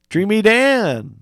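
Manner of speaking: high, slow, stretched DREAMY DAN; drawn-out name. Acted. Player invokes dream figure's name.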